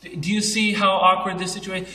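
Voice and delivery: ordinary talk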